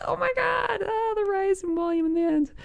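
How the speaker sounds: high, breathy cry of delight; giddy squeal. Real, not acted. Trader gushing at his chart's rising volume, high giddy squeal. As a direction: squeal over the volume spike.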